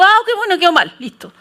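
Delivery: quick, high, unbothered acceptance; breezy shrug-off. Acted. Pastor takes criticism of her work, breezy shrug-off. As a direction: brush it off lightly. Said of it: "yo soy más carepalo".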